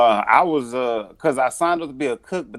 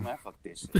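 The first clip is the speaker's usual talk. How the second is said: muttered curse; 2 semitones lower, 7 dB quieter than usual. Retold or imitated. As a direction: curse the wind under your breath. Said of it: "I was like".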